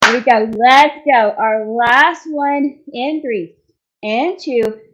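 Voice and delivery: loud, driving countdown push; rallying shout. Real, not acted. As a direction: fire the class up for the last rep. Trainer pumping up final set.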